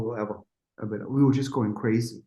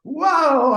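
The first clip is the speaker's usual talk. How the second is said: high, loud wail; imitated belting; 12 semitones higher, 5 dB louder than usual. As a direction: mimic a singer blasting a huge note. Imitating Kelly's huge singing.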